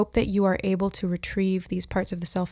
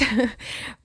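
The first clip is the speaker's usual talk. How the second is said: laugh; 3 semitones higher than usual. Real, not acted. Laughing about own bankruptcy.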